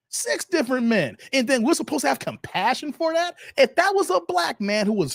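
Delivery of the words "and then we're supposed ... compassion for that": ordinary delivery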